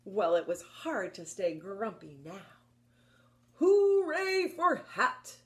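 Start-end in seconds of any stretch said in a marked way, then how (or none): 3.6-5.4 much higher, loud, slow cheer; happy elephant cheer. Acted. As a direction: cheer it out with joy. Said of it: "Elephant cheered".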